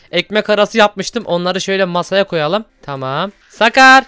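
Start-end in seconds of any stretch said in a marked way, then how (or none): 3.5-4.0 calling out. Acted. Calling child to the table.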